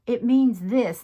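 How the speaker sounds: a touch of defensive certainty; insistent. Retold or imitated. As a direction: state it like it's obvious. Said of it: "And I was like".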